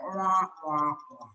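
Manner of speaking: lightly imitated wah-wah drone; low-key mock classroom drone; Charlie Brown teacher. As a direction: mimic a lecturing teacher as nonsense. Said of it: "Charlie Brown was like".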